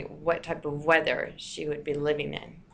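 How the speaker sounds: ordinary delivery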